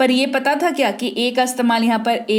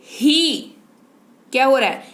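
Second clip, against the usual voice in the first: ordinary talk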